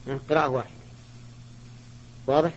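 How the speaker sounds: ordinary talk, nothing marked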